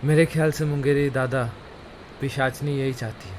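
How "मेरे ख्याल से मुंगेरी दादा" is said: ordinary delivery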